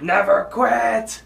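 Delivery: high, loud drawn-out yell; acted yell. Rigby made to yell over the weed whacker, strained shout. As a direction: yell it long over a motor. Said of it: "to make Rigby yell".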